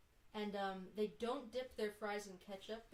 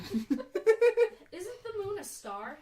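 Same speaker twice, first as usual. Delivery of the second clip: high, loud, very slow, laughing; laughing quote; 10 semitones higher, 12 dB louder, much slower than usual. Retold or imitated. Host quoting favorite video, slow laughing mock. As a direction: drag the quote out through laughter.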